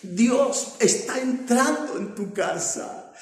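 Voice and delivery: loud, slow, rising preacher's proclamation; preaching at full force. Acted. Preacher proclaiming God's power to the congregation, full force. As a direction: drive each phrase home to the church.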